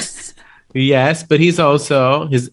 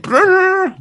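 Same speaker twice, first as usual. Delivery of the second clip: high trilled "brrr" sound effect; imitated malfunction noise; 3 dB louder than usual. Explaining how the chip went off, high imitated buzz. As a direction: trill a high-pitched burst of machine noise. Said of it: "So it went".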